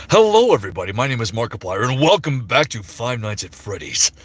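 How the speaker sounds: Markiplier. Shown as a demonstration. Streamer showing he can do Mark, booming host intro. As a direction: punch the greeting like a big video intro. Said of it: "Oh, I can do Mark".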